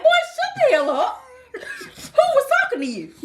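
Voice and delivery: high, laughing sassy put-down; mock telling off. Woman replaying how she tells her man off, high and sassy. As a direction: snap at him while cracking up. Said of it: "And I'm like".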